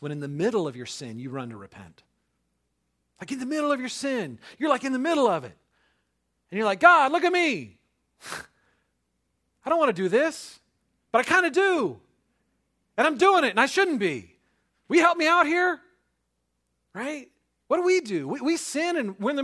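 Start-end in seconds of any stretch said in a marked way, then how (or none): 3.2-6.8 high, loud, driving sermon voice; fervent preaching. Acted. Pastor preaching hard to his congregation, fervent and loud. 6.8-15.8 loud, high, desperate plea to God; pleading prayer. Pretend. Sinner caught mid-sin begging God for help, loud and high.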